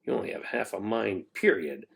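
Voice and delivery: soft low mutter; muttered under breath. A narrator's reading. Zoe quietly mocks the principal, muttering. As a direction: mutter it so he can't hear. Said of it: "Zoe muttered under her breath".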